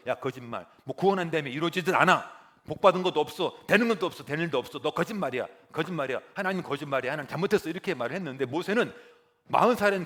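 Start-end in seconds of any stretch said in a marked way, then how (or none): 0.1-7.7 loud, higher angry yelling; furious accusation. Acted. Israelites yelling at Moses, furious shouting. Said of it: "이스라엘은 포기했죠".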